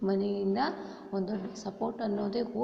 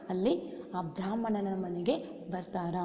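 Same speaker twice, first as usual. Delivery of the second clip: usual speaking voice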